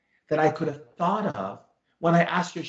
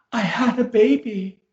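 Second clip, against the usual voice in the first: higher, louder emotional announcement; acted tearfulness; 7 semitones higher, 6 dB louder than usual. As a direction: play a client overcome with emotion, voice raised. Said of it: "she starts crying".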